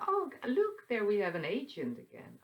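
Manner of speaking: high, loud playful exclamation; playful mock surprise. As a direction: react in lighthearted mock surprise. Acting out playful reaction to an agent.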